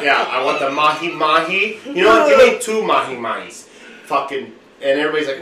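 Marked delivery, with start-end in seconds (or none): 1.9-3.7 extravagant order. Retold or imitated.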